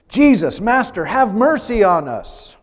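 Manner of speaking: loud, high calling out from a distance; pleading call for mercy; desperate cry. Acted. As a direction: cry out to be heard from far off. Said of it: "they call out to Jesus".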